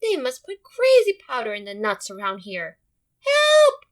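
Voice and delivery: loud, frantic dog ending in a very high yelp; a scared dog's squealing cry for help; panicked dog. Acted. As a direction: cry for help while running away. Frightened dog in story.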